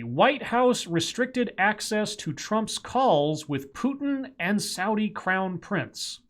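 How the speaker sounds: slow headline read; news read. Acted. Reading headline.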